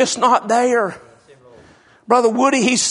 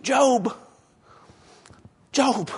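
soft, tender calling of his name; wife calling gently; 7 dB quieter than usual. Acted. Wife softly calls her suffering husband Job, tender and hushed.

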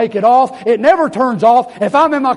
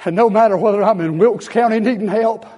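ordinary talk

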